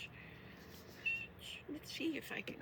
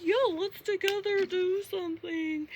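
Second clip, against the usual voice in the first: mocking friends; 8 semitones higher, 8 dB louder than usual. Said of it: "They all like".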